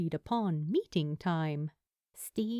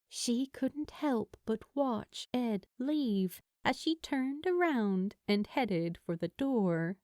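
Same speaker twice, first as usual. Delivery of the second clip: ordinary delivery, nothing marked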